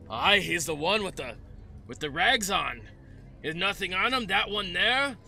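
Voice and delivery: building to a loud shout of "Get him!"; rally the men, ending in a yell; guard rallying. Acted. GM voices guard rallying a chase.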